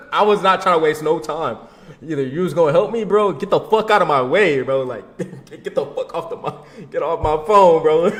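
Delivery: can't help laughing at himself; laughing while telling. Real, not acted. Telling how he hung up on his girlfriend, laughing loudly.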